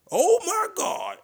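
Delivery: very high, loud, slow squealing "oh my God"; shrieking falsetto exclamation; Laughing Lenny impression. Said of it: "that's how he sounds".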